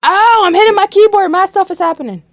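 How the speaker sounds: sudden high, loud alarmed outburst; startled shout. Real, not acted. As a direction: blurt it out in a real startle. Startled by the keyboard falling.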